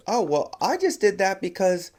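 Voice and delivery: high-pitched retold old-lady voice; great grandmother. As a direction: lightly play great grandma, voice lifted high. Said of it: "the great grandmother goes".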